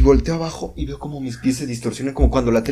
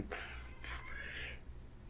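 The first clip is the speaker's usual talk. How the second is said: whispered pulsing pum; 24 dB quieter, much slower than usual. Retold or imitated. Flowers pulsing sound.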